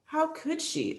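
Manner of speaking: ordinary delivery, nothing marked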